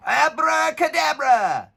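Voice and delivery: high, slow, hammed-up magic chant; goblin spell shout. A narrator's reading. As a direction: wave the wand and cast a spell. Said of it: "shouted the Grabbing Goblin".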